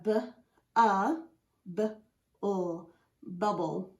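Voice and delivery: slow "b, a, b, ul" then "bubble"; sounding out bubble. Shown as a demonstration. Teacher sounding out bubble for pupils. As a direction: segment each sound, then blend. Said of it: "So we have".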